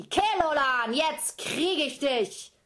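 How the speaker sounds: loud, high, slow yell of a giant; giant mother yelling. Acted. Giant mother yells at fleeing Kelolan, loud and high. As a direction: bellow after him as you chase. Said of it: "Und sie schrie".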